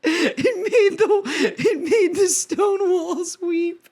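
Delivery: high, slow, laughing mock singer voice; mock Orpheus. Teasing the actor's line, high and slow, half laughing. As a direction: ham up the naive lover boy through giggles.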